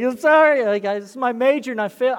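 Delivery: loud, high pleading sorry; emotional apology. Retold or imitated. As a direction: apologise to the son, nearly crying. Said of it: "I'm like".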